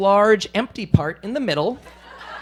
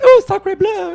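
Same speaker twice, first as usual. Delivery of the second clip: high, loud mock French cry; hammed-up French accent; French exclamation; 13 semitones higher, 8 dB louder, slower than usual. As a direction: yelp it like a shocked Frenchwoman. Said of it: "and it was".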